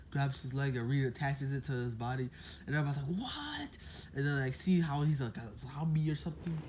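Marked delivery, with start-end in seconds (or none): 3.2-3.7 astonished what. Retold or imitated. Everybody reacts, astonished.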